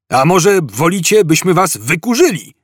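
shouted threat. A narrator's reading. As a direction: call out a threat to fugitives. Cop threatening across the hall. (wykrzyczana groźba)

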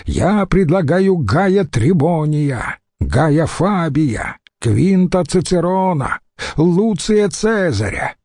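high, slow ranting list of names; raging. Acted. Antony rages at the senate, shouting names high and slow. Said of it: "бушевал Марк Антоний".

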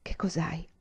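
soft, breathy whisper; wife whispering to her husband in bed; murmured. A narrator's reading. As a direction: barely breathe the question. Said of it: "poi mormorò".

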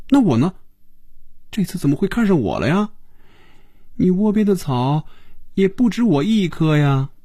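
put-on high, insistent girlish questioning; eager female challenge. Phoebe demanding why Hongjun picked her, high challenging role voice. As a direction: jump in with a high, pressing question.

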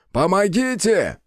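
high, stretched-out plea; acted shout to be rescued; cry for help. A narrator's reading. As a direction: call for help high and drawn out. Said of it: "закричал орк".

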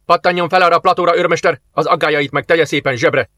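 brisk, high, commanding; officer cutting short a sergeant's doubts; forceful order. A narrator's reading. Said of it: "szólt erélyesen Jarisov".

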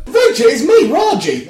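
high, loud and giddy; bursting with excitement at a familiar face; excited. Acted. Greeting his old friend, thrilled and loud. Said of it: "I said all excited".

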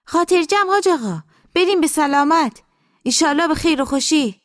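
high, loud, effusive send-off; obliging housekeeper. Acted. Housekeeper sees the master off, high and effusive. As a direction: reassure him in a high-pitched servant's voice.

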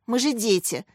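ordinary delivery

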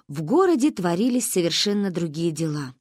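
plain reading voice

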